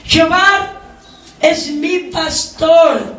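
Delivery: "Jehová" rung out high and loud, "es mi pastor" slow; preacher's emphatic psalm line; emphatic quote. Shown as a demonstration. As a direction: call out "Jehová" then slow down. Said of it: "¿Acaso dice Jehová es pastor de todos?".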